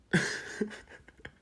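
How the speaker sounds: high, airy smug giggle; high breathy giggle. Acted. Hinting she kept bullies away, a high breathy giggle. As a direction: giggle knowingly before the reveal.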